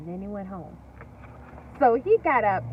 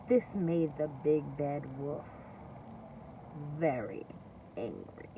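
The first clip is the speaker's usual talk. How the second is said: low menacing narration; 10 semitones lower, 9 dB quieter than usual. A narrator's reading. Narration sinking for angry wolf.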